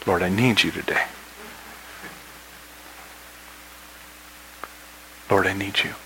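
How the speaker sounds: soft repeated prayer; an understated cry to God; hushed plea. Acted. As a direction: pray it quietly, with a long pause before repeating. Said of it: "that's one of my cries".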